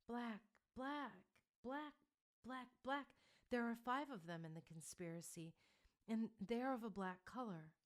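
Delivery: high, strained words forced out; a seer struggling to speak; speaking with difficulty. Acted. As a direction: push each word out against resistance. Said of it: "The answer came with difficulty".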